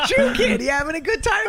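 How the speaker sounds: high, loud, laughing question; hammed-up laughing shout; laughing high retake. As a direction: ask it again in mock rage. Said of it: "I go".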